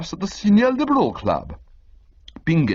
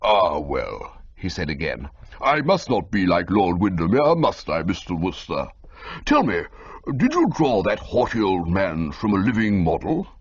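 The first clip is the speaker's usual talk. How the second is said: lightly voiced old lord; 7 semitones lower than usual. A narrator's reading. Old lord Bittlesham speaking.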